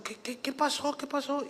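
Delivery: high, hushed, repeated questioning; mock alarm. Preacher rushes out to the screaming, mock alarm. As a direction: stammer it high, as if startled.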